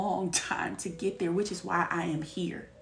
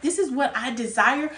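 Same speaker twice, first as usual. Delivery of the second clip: louder, insistent declaration; retold firm demand; 4 semitones higher, 6 dB louder than usual. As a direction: lay down exactly what you want. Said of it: "I'm like".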